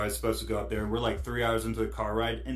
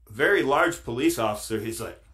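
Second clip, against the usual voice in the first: ordinary delivery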